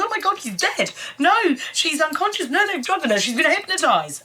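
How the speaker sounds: high, hammed-up rapid panic; imitating overdramatic dub line; mock hysteria. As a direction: rattle off wild guesses in mock alarm. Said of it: "the first thing she goes".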